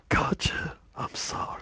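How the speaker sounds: whispered mock apology; bad game impression. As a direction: whisper it as a hammed-up farewell. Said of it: "The impressions are getting worse and worse".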